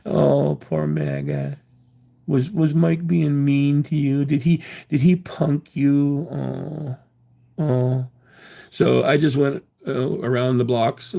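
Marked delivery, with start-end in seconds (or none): 0.1-8.1 slow, cooing sarcastic sympathy; fake-console the sore losers; mock pity.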